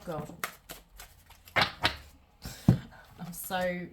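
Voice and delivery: ordinary talk, nothing marked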